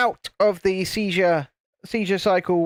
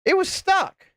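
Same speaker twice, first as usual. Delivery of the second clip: high-pitched; 12 semitones higher, slower than usual. Real, not acted.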